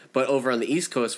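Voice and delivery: ordinary delivery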